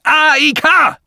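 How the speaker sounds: high, slow, full-force shout; loud vehement call. A narrator's reading. Old man calls his machine over the intruders, shouting. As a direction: cut in with an urgent summons. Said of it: "rief er so laut".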